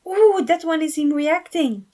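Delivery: high, loud eager pointing-out; thrilled to spot the video; excited exclaim. Acted. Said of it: "Felix exclaimed".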